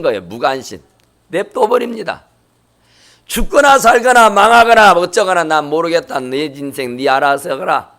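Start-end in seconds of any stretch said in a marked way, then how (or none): 5.4-7.9 indifferent parent. Retold or imitated. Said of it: "하고 딱 뒷짐져버리고".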